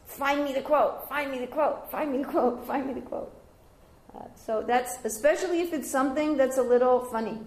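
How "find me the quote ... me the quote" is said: insistent. Retold or imitated. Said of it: "kept writing these emails".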